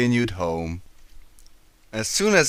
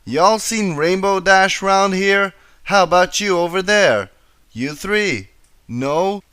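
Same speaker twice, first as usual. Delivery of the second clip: frustrated Applejack; 7 semitones higher, 4 dB louder than usual. A narrator's reading. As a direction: demand answers from passersby. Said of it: "She stamped her hoof in frustration".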